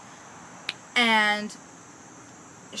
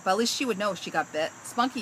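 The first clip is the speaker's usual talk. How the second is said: ordinary delivery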